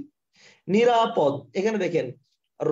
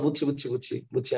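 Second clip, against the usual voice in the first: much softer and lower, under his breath; muttering to himself; 8 semitones lower, 6 dB quieter than usual. Real, not acted. Teacher stuck on screen sharing, muttering to himself. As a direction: mumble it to yourself while fiddling with the screen.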